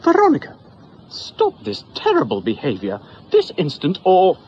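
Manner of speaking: high, loud scolding; mother scolding her daughter; scolding scream. Acted. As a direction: snap at the child. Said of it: "she screamed".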